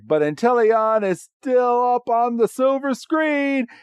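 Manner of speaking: high, cartoonish proclamation; put-on villain chorus. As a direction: imitate villains calling out as they fly off. Voices Team Rocket's retort.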